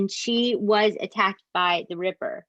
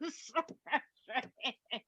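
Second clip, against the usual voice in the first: laughing exasperation; 6 semitones higher, 9 dB quieter, slower than usual. Real, not acted.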